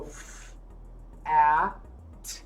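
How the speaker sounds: f, a, t said as separate sounds; sounded out. Shown as a demonstration. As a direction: tap out each sound of fat. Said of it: "I can just go".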